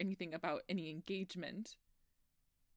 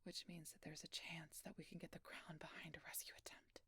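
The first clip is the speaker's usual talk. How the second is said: hushed, breathy whisper throughout; a frightened secret whispered to the doctor; whispered confession; 11 dB quieter than usual. Acted. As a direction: murmur the story as if guards could hear. Whisper returns while plotting.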